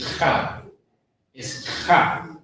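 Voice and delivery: "ha" with a strong airy H; H put in. Shown as a demonstration. Teacher correcting student, "ha" with the H clearly breathed. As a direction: push plenty of breath through "ha". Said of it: "put in the H in there as well".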